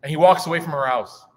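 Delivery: ordinary talk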